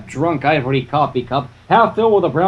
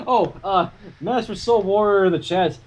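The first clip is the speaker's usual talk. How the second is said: ordinary talk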